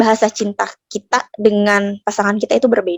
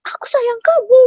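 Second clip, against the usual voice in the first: much higher, hammed-up angry declaration; mock angry scolding; 3 dB louder than usual. Shown as a demonstration. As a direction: say it as if glaring, hands on hips. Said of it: "sambil melotot, sambil berkacak pinggang".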